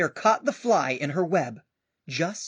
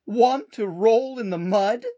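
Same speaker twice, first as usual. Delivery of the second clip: pig voice; 6 semitones higher, 7 dB louder than usual. Acted. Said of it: "grunted the pig".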